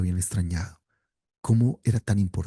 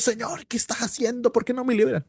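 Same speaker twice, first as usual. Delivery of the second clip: much higher pleading complaint to God; reproachful complaint; 13 semitones higher than usual. Retold or imitated. Preacher imitates a complaining plea to God, much higher. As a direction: voice a man reproaching God, pitched high. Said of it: "no le está reclamando a Dios".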